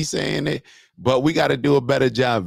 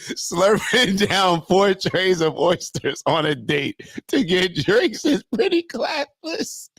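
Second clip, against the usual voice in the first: laughing while reading; 6 semitones higher, slower than usual. Real, not acted. Reads comment cracking up.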